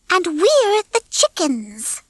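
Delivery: high, slow, simple hen voice; helpful hen Babs. A narrator's reading. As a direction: chime in slowly and helpfully.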